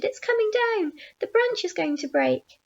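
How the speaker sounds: high-pitched alarmed call; shouted warning. A narrator's reading. Aunt seeing the branch bend, high alarmed shout. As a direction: warn them urgently, pitched high. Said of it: "Aunt Sponge shouted".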